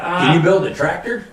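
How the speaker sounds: high, loud, eager question; voicing the farmer. Retelling the farmer asking for a model, high loud eager. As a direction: ask it like an excited farmer hoping for a yes.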